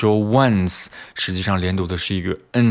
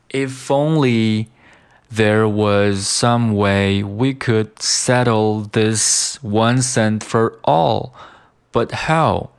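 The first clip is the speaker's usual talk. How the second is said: "If only there was some way" slow, phrase by phrase; slow model reading; 2 semitones higher, much slower than usual. Shown as a demonstration. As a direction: read "once and for all, but how" deliberately, with pauses. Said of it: "就是读成了". (慢速分段朗读)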